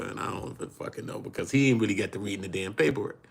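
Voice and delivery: ordinary talk, nothing marked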